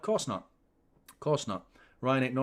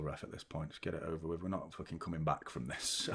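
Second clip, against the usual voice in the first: much lower, much quieter, drained; defeated; 7 semitones lower, 6 dB quieter than usual. Real, not acted. Fan giving up on the match, defeated. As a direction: mutter it like all hope is gone.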